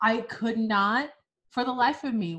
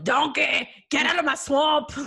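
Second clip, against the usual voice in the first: loud, high mock holler; Shrek's yell; 6 semitones higher, 7 dB louder than usual. Joking to friend about onion breath, Shrek yell. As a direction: bellow it like an angry ogre. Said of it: "about to start yelling".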